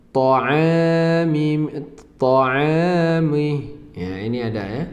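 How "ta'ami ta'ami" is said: "Ta'ami" with a full, rounded to; heavy to sound. Shown as a demonstration. Teacher showing the difference between to and ta, clearly pronounced. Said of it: "Misalnya contoh".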